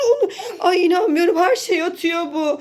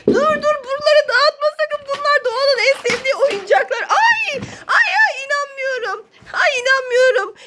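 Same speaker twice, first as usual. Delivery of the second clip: high alarmed cries; 9 semitones higher than usual. Real, not acted. Mother wailing as Masha wrecks the toys. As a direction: cry out in disbelief at the mess.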